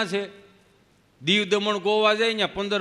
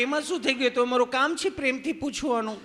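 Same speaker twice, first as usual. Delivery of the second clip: nurse voice; 6 semitones higher than usual. Retold or imitated. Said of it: "nurse બેન બેઠેલા".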